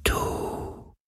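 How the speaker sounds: very slow, breath-only whisper; hauchzartes Flüstern. A narrator's reading. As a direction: whisper it like a faint, dying wind. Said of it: "so leise wie der Schatten des Hauchs eines Flüsterns". (gehauchtes, sterbendes Flüstern)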